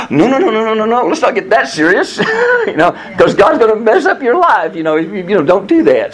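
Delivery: high, fretful parent pleading; a worried parent waving the idea away; anxious parent. Retold or imitated. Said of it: "And the parents say".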